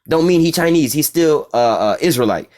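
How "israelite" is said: ordinary delivery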